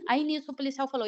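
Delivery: ordinary delivery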